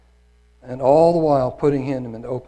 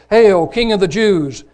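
loud, higher, slow mock homage; mocking soldiers; 6 semitones higher, 9 dB louder than usual. Soldiers kneeling to mock Jesus, loud slow jeering. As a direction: jeer it out like a soldier bowing in scorn.

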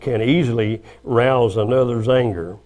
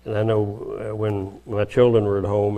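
normal talking voice

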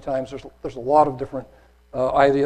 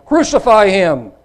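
loud, high, drawn-out demand; crying out; 7 semitones higher, 10 dB louder, slower than usual. A narrator's reading. Crowd cries out again to Pilate, loud demand. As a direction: cry it out slowly as the crowd. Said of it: "they cried out again".

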